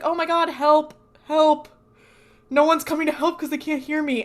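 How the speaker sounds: hammed-up yelling for help nobody hears; mock cry for help. Dean calling for help in the hospital, loud mock panic.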